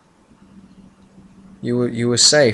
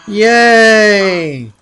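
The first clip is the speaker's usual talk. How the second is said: excited cheer; 9 semitones higher, 6 dB louder than usual. Real, not acted. Cheering on return to series.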